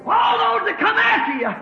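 high shouted promise easing down; full-force preaching. Real, not acted. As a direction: revival shout falling to a measured close. Preacher shouts promise, then settles.

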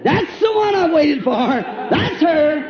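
high, excited cry of recognition; thrilled outburst with a laugh in it; delighted king. Acted. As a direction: shout it like a smitten king spotting his bride.

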